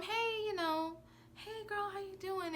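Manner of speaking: high, soft fake-nice greeting; fake sweet. Mocking the friend's sugary greeting, high and soft. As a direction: coo it sweetly, mocking her.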